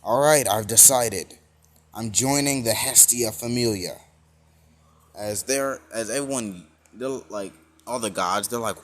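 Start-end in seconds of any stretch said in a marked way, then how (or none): 0.0-4.0 loud, emphatic announcement; retold yell. Deku announces his choice to the gods, yelling out. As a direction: declare it forcefully at raised volume. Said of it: "and he yells out".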